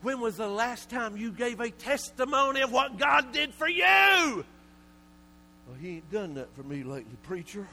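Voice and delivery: loud, high, rising preacher's call; preaching at full force. Real, not acted. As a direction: hammer each question at the congregation. Preacher building to a shout.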